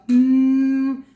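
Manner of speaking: brief firm hum out; short loud hum. Shown as a demonstration. Swim coach demonstrates the exhale, short loud hum. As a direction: push a quick hum of air out. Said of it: "as you exhale".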